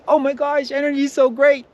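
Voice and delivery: high, loud, overeager gush; gushing excitement. Retold or imitated. Gushing about energy to a norm, overexcited. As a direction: oversell it with a squeal. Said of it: "if you go with like".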